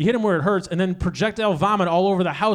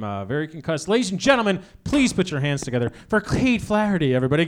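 usual speaking voice

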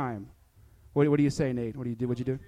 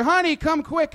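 calling out; 10 semitones higher, 5 dB louder than usual. Retold or imitated. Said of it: "and you're".

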